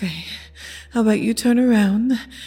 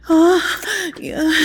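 high moaned oh; 8 semitones higher than usual. Acted. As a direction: let the oh leap up in pleasure. Moaning during sex.

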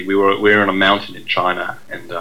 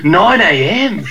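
mock Australian accent; 9 semitones higher, 7 dB louder, much slower than usual. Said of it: "imitate the accents that we hear".

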